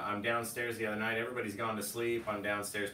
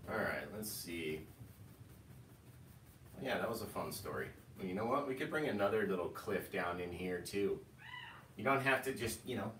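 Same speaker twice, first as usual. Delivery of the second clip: normal talking voice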